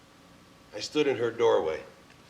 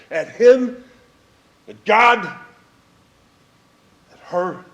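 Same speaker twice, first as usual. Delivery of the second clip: furious; 8 semitones higher, 12 dB louder, slower than usual. Real, not acted. Furious at him, at God.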